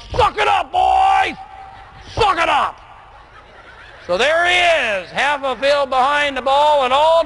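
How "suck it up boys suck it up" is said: high barking coach shout; bellowing from the sideline at his players; coach's yell. Retold or imitated. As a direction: yell it at the boys like a gruff coach. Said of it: "His favorite comment is".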